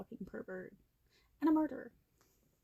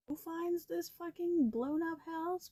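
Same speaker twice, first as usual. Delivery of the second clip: high indignant; 8 semitones higher, slower than usual. Real, not acted. Indignant rhetorical question.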